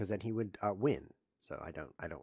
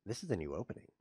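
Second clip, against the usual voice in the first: usual speaking voice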